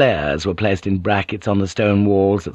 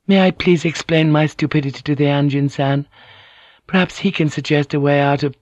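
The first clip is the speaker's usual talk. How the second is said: higher, meek contrite request; penitent; 6 semitones higher than usual. A narrator's reading. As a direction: ask humbly, leaving the sentence hanging. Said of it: "She let her words fade away penitently".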